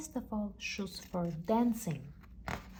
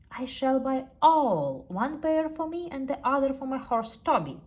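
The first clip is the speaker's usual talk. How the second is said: grand knight; 7 semitones higher, 7 dB louder than usual. Acted. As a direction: proclaim the purchase grandly. Same knight continues.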